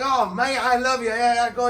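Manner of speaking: high, loud, gushing imitation; excited Australian mate. Imitating an Australian guy he met, excited. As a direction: imitate a thrilled Aussie, pitched right up. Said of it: "and he's excited".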